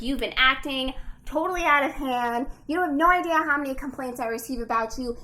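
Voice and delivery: loud, acted scolding; boss going in. Boss berates Elizabeth over complaints, imitated angry scolding. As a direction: rattle off grievances as an angry boss. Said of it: "going in on her".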